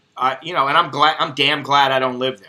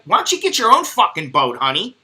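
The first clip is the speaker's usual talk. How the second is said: higher, louder scolding rant; telling off the demanding contestant; annoyed rant; 9 semitones higher, 3 dB louder than usual. Real, not acted. Viewer ranting at a TV contestant about boats, loud and high.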